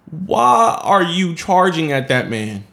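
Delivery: drawn-out, stressed why; slow incredulous question. Real, not acted. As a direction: spell out the disbelief word by word. Repeats question in disbelief.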